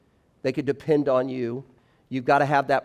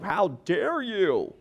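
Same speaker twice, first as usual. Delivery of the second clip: put-on slow, higher, offended teen voice; mock indignant son; 5 semitones higher, much slower than usual. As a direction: play the outraged kid, drawn out. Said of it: "and Keegan's like".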